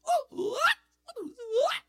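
very high, loud mock shout; caught up in the spirit. To the viewers, imitating a churchgoer's very high mock shout. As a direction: cry out like the spirit hit. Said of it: "caught up like this".

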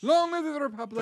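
high, hammed-up rebel declaration; mock rallying cry. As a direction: proclaim it like a mock revolutionary. Mock rebel declaration in Irish accent.

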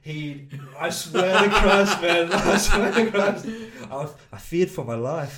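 can barely get it out for laughing; laughing hard. Real, not acted. Bouncer recalls the fight, breaking up laughing.

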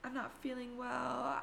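texting clients a fake sick excuse, sweetly. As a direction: put on a sweet apologetic lie. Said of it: "make up this complete lie".

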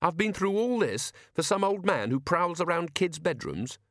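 indignant. A narrator's reading. Susan arguing back at Death about the task, indignant and sarcastic. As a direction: protest indignantly, pitch jumping up.

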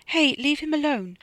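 high-pitched indignant protest; protective protest. A narrator's reading. Bunty steps between Dono and Freddy, protesting. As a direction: call him off, pitched well up.

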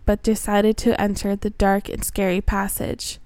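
usual speaking voice

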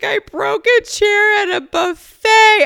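squeaky high, loud imitation of husband; mock husband voice. Mocking her husband after he broke a buffet chair, high and loud. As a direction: squeal it like a giddy big guy. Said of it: "He's like".

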